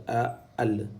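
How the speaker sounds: "a" held long, then "al" joined as the article; "a" allongé puis "al"; drawn-out "a". Shown as a demonstration. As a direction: stretch "a" before closing on "al". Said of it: "C'est un alif qui va avec le al ici".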